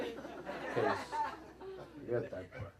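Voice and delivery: whispered laughing aside, very slow; whispered aside with a chuckle. Real, not acted. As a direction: breathe it out through a laugh, no voice. Whispered aside about the LSD.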